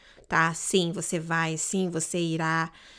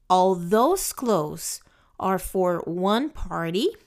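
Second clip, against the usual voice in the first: slow teaching read; 2 semitones lower, slower than usual. Shown as a demonstration. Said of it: "Vou falar mais uma vez, pra vocês pegar a pronúncia".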